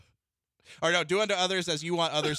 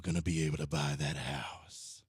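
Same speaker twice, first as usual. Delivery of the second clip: deep, slow crooning lover voice; low smooth reassurance, drawn out; creepy seductive voice; 10 semitones lower, 3 dB quieter than usual. Pretend. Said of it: "the Kool-Aid Man voice he had going".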